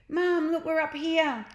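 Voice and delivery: high, loud excited call to mum. Acted. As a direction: shout down to mum below. Kids calling to mother below.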